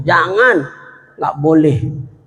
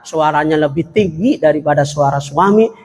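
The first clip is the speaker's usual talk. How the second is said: usual speaking voice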